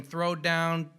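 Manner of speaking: ordinary talk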